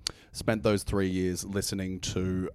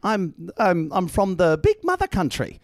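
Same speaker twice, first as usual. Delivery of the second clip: much higher, louder hammed-up Aussie star; Hugh Jackman impression; 11 semitones higher, 5 dB louder than usual. Host asks for the celebrity, hammed-up Hugh Jackman voice. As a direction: beam like a cheesy celebrity. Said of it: "an impression of Hugh Jackman".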